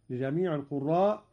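usual speaking voice

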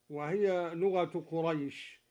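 ordinary talk

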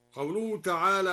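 normal talking voice

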